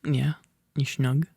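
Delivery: plain reading voice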